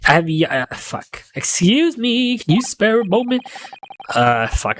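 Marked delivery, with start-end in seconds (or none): 1.3-3.4 put-on young man voice. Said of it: "I need a voice for this guy".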